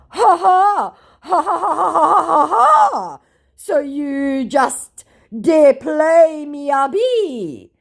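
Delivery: high, loud fake laugh into slow taunt; hammed-up scammer cackle and drawn-out gloat; mocked scammer. Scammer gloating back at the baiter, hammed-up high voice. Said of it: "came back with".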